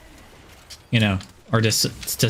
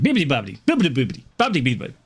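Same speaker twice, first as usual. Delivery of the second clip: mock Italian gibberish; 8 semitones higher, much faster than usual. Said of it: "all it takes to speak Italian is to wave your arms around".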